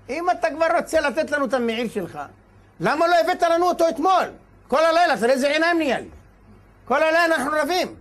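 high, loud exasperated protest; acted outrage of a worn-out litigant; indignant complaint. Father complains to rabbi.

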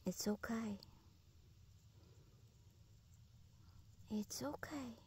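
barely breathed reassurance; soothing whisper. Real, not acted. Whispering comfort to a frightened wild animal. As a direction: hush it softly, over and over.

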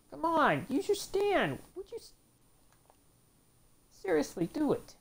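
squeaky disbelief at his own fighter; exasperated pleading. Real, not acted. Gamer begging his character to use its stand, exasperated squeak.

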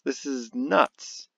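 normal talking voice

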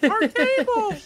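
high, loud laughing shout. Real, not acted. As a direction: cry it out through laughter. Laughing cry over broken egg.

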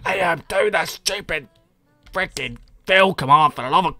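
mock a ranting, impatient viewer; imitated raging. Streamer replaying a viewer's demand at him, mock exasperated rant. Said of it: "R six raging at me".

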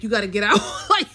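breaking into a laugh mid-quote. Real, not acted. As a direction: let a helpless laugh take over the words. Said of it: "I don't know why I'm laughing".

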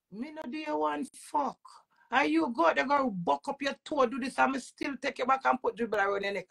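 normal talking voice

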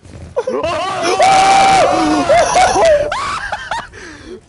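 high shrieking laugh; panicking gamer. Real, not acted. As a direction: cackle loud in panic. Panicked laugh under fire.

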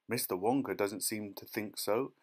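ordinary delivery, nothing marked

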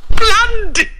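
high, loud shriek; hysterical cry. Acted. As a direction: shriek the word in horror. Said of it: "she cried hysterically".

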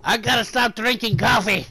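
high, loud put-on outburst; worked-up ranter. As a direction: blurt it out as a wired ranting guy. Acted outburst in the same voice.

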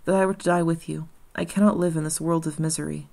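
plain reading voice

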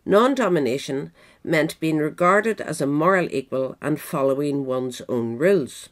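ordinary talk, nothing marked

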